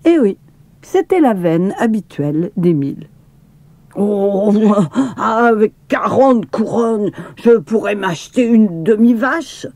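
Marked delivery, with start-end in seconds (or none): 4.0-9.7 outraged cry. A narrator's reading. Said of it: "s'écria-t-il outré".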